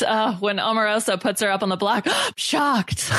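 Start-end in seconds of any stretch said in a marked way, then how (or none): 2.1-3.1 mock shocked. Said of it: "That's the new meme".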